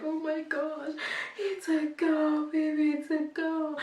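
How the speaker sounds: put-on overjoyed new mother telling husband it's a girl. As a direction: gush it to her husband.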